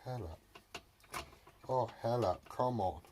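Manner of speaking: normal talking voice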